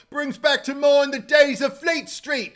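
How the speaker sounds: high, loud Cockney cabbie; Cockney cab driver. Retold or imitated. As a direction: play the chatty London cab driver, hammed up. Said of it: "kind of like the cab driver in this movie".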